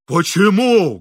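shouting. A narrator's reading. Engineer dodging the priest's arms, shouting. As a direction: shout the question in bewilderment. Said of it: "кричал инженер".

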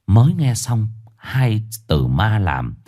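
plain reading voice

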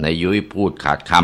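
ordinary delivery

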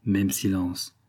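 usual speaking voice

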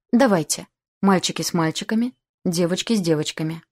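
usual speaking voice

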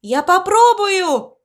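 ordinary delivery

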